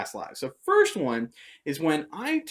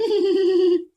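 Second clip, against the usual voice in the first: high, loud giggling laugh; re-enacted helpless high cackle; cracking up; 7 dB louder than usual. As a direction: burst into a high mock giggle. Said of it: "I am cracking up".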